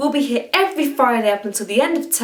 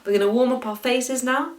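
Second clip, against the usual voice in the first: ordinary talk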